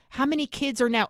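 usual speaking voice

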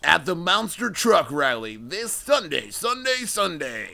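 slow booming announcer hype; monster truck ad. Pretend. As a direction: boom it out like a rally ad.

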